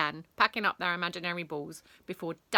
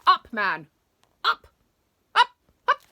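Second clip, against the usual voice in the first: loud, high, impatient headmistress; escalating short shouts; barked command; 11 semitones higher, 14 dB louder than usual. A narrator's reading. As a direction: order him up sharply. Headmistress orders teacher off the ground.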